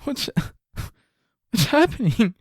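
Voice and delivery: high, bewildered whimper; mock confused Wahlberg. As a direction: sound lost and near tears. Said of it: "he starts to, like".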